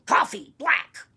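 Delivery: loud, gruff order; grouchy old man. Shown as a demonstration. Showing the child the old man's order, grouchy. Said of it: "Say it like an old man, grouchy".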